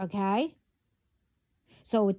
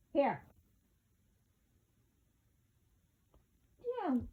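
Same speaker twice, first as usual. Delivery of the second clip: calling from far away; 9 dB quieter than usual. Real, not acted. Parent calling child from afar.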